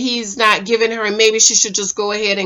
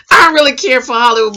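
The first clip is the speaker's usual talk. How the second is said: ordinary delivery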